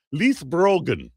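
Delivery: a light, self-mocking stab at an Irish accent on "Leith Brogan"; terrible Irish accent. Shown as a demonstration. Host tries the Irish term for viewers, in a mock Irish accent. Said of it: "this terrible Irish accent you're getting from me".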